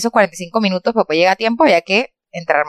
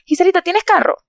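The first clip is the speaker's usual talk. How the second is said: higher, sing-song manager voice; imitating the manager; 9 semitones higher than usual. Manager asking Giselita for a car, higher sweet voice. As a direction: ask it sweetly in her voice.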